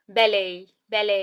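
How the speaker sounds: बैले said as bal-lay with no t at the end, repeated; silent t. Shown as a demonstration. Teacher showing students ballet's silent t, bal-lay. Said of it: "ट तो बिल्कुल silent है".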